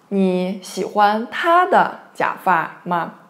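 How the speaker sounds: ordinary talk, nothing marked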